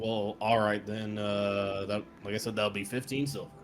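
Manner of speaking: a hint of a weary shopkeeper; dry innkeeper. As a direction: innkeeper settling the bill, unimpressed. GM voicing innkeeper.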